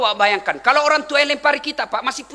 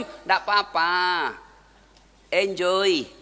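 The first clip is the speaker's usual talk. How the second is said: slow, softer easygoing reply; unbothered, smiling; 5 semitones lower, 4 dB quieter, much slower than usual. Retold or imitated. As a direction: brush it off with a relaxed smile. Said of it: "Nabi tetap senyum".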